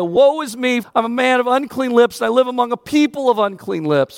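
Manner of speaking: lightly voiced Isaiah crying woe; Isaiah lamenting. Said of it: "We'd be like Isaiah".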